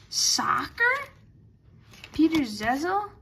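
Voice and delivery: normal talking voice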